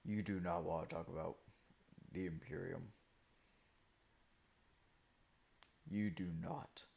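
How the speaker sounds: deep, hushed menacing voice; ominous low warning. Acted. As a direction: warn them darkly, low and soft.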